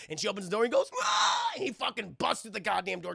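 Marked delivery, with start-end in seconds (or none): 1.0-1.5 very high imitated scream; sudden mock monster shriek; zombie screech. Lewis bursts out at Anna, mock zombie screech.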